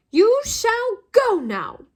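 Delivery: high, slow and fierce, each word drawn out; fierce elf command. A narrator's reading. House-elf ordering Lucius Malfoy to leave, fierce and high. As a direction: order him off like a furious little house-elf. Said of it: "he said fiercely".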